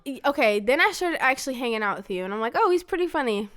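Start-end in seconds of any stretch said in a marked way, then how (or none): 2.6-3.5 high, lightly surprised realization; as if just warming to someone; pleasantly surprised. Retold or imitated. Daughter recalling hanging out with her dad, pleasantly surprised.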